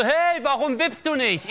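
put-on high, slow kid asking, laughing through it; a little fat boy calling from the seesaw; high child voice. Fat kid on the seesaw asks him why he won't seesaw, high and slow. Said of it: "Der so".

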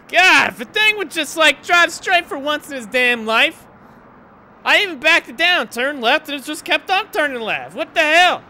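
loud, high furious complaint; angry rant. Real, not acted. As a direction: vent at the car that won't go straight. Ranting after spinning out.

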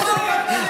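short high laugh. Real, not acted. Laugh after joke.

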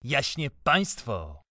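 slow, contemptuous, through gritted teeth; spat through teeth. A narrator's reading. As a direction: squeeze it out with slow scorn. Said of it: "strzyknął tym słowem przez zęby".

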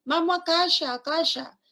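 put-on a high child-like Vamos a calla with sh for s; imitating a child. Teacher imitating a child's lisped casa. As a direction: pitch up and say calla like a little kid. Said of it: "algunos niños cuando pronuncian no dicen".

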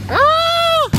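a very high, joyful scream; ecstatic shout. Real, not acted. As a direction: scream out pure delight. Sitting in the E-Type.

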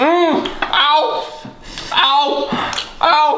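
repeated pained ows, high; mouth burning from spicy food. Real, not acted. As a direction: wince at the burn.

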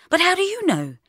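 high, pleading question; desperate. Acted. Bridget to Mark about the interview, desperate. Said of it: "looking up at him desperately".

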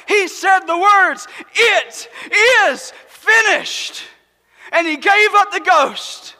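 loud, driving preacher's build-up; preaching at full force. Acted. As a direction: preach it out to the back row. Preaching the crucifixion.

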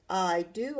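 ordinary delivery, nothing marked

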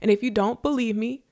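ordinary talk

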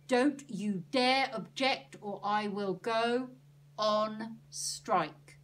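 loud, slow, deliberate warning; emphatic, forceful ultimatum; stern threat. A narrator's reading. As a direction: lay down the threat word by word, loudly. Annie threatens Ernie with a strike.

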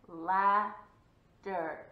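LAD, DER with a long gap, loud; slow, loud Ladder with a pause mid-word; syllable by syllable. Shown as a demonstration. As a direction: stretch Ladder into two separate parts. Chin check, syllable by syllable.

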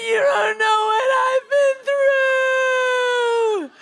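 high, loud, slow crying shout; sobbing yell. Retold or imitated. Yelling back at the roommate, sobbing and shouting. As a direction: wail it through tears.

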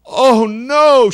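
high, loud cry of despair; high, loud horrified wail; anguished cry. A narrator's reading. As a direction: cry out in horror. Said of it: "she cried".

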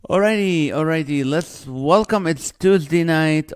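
rally the viewers like a show host; excited host welcome. Real, not acted. Host opening the live show for viewers, loud excited welcome.

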